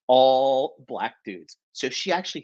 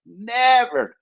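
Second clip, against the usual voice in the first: Never, shot up high and loud; emphatic never; 10 semitones higher, 7 dB louder than usual. Real, not acted. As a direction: slam down the word Never. Insisting Japanese girls never say it.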